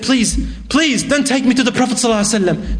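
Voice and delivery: high, rushed, frightened begging; scared pleading. Acted. Young man begging the companions, high and frightened. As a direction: beg them in terror not to take him. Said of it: "I'm too scared".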